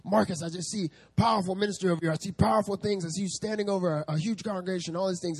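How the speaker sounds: imitating the prophet. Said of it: "and he's like".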